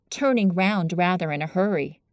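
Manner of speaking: plain reading voice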